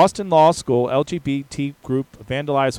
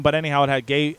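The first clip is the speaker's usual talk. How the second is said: usual speaking voice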